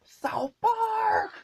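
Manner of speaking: shrieking cartoon voice; over-the-top cartoon squeal. Shown as a demonstration. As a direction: squeal the name like a crazed cartoon. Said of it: "that was a little over a top".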